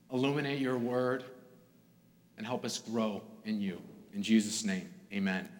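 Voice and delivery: soft, hushed prayer that fades to the amen; quiet reverent prayer voice; opening prayer. Acted. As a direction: pray gently over the congregation.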